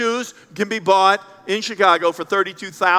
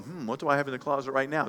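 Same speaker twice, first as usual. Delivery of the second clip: muttering to self; 6 semitones lower, 10 dB quieter, faster than usual. Retold or imitated. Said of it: "some of you are going".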